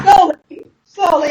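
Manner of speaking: high, drawn-out coaxing; coaching a friend through a hard word; coaxing slow. Shown as a demonstration. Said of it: "Say it slow".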